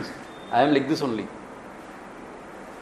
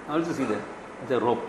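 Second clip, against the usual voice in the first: calm insistence; 4 semitones lower, 9 dB quieter, faster than usual. Acted. Two people quarrel over snake.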